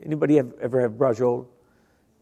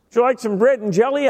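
higher, louder polite offer; much higher sugary offer; prim hostess; 8 semitones higher, 3 dB louder than usual. Retold or imitated. Host offering.

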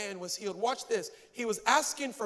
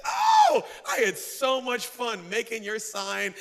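gushing mock cheer, slow; slow, happy clerk; 6 dB louder, slower than usual. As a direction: imitate an overjoyed shop clerk, taking your time. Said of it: "not only was she slow, but she was happy".

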